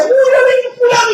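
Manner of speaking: high, fast, half-crying mock excitement; hammed-up eager squeal; imitating excited mother. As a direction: mimic mom rushing off to hear a preacher. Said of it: "mi mamá era de aquellas que decía".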